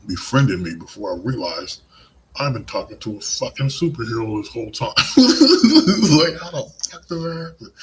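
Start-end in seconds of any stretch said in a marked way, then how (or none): 2.4-7.7 talking through a burst of real laughter; laughing in disbelief. Real, not acted. Telling viewers he befriended a superhero, laughing. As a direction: crack up at the absurdity, voice climbing high. Realizing friend was a superhero.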